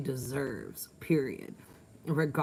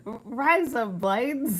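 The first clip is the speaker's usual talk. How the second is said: mock Australian; 7 semitones higher, slower than usual. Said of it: "I'm being Australian".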